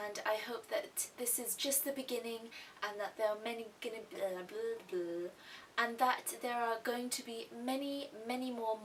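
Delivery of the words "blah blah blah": ordinary talk, nothing marked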